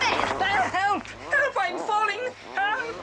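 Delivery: startled cries for help while falling; calling for help. Acted. Horace tripped into a hole, crying out for help. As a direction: yell for help as you tumble in.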